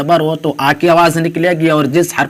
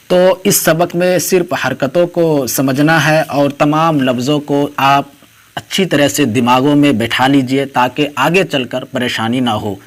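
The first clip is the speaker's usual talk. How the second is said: usual speaking voice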